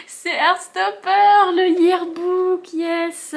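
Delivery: high, slow, laughing squeal of delight; excited squeal. Real, not acted. Fan opening a parcel squeals over the yearbook, laughing. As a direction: gush over the book like a thrilled fan. Unboxing favourite book, squeals.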